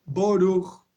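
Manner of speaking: Boruch, slow, with an o in the first syllable. Shown as a demonstration. Teacher demonstrates his own Boruch. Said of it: "como pronuncio yo".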